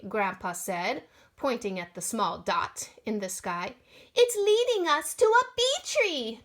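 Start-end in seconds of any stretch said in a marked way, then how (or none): 4.1-6.4 much higher, loud wail; girl wailing to the musicians. Acted. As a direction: wail it out while streaking by. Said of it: "Mary Ellen wailed".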